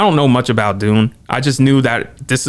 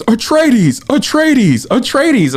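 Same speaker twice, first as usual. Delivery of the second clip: mock army scream; 10 semitones higher, much slower than usual. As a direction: chant it like a whole army roaring the house name. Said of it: "Their army screams".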